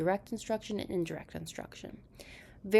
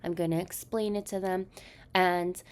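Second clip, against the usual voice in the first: ordinary talk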